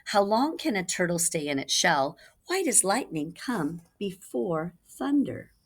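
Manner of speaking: ordinary delivery, nothing marked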